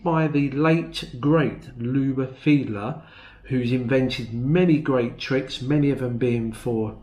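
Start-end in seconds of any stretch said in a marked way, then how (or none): none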